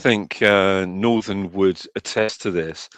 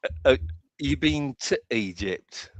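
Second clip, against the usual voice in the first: softer, put-on Yorkshire "you been to Egypt"; mock Northern accent, higher and quieter; another Northern dialect; 4 semitones higher, 5 dB quieter than usual. Shown as a demonstration. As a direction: imitate a different Yorkshire local. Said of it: "there's the ones that say".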